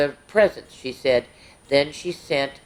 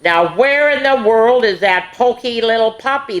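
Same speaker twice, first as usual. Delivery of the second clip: calling out; 6 semitones higher, 4 dB louder than usual. Acted. Puppies calling for Pokey.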